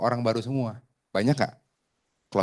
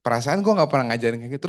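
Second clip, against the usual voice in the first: ordinary delivery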